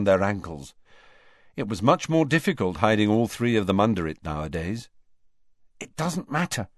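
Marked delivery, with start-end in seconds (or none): 5.8-6.7 impatient. A narrator's reading. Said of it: "said Harry impatiently".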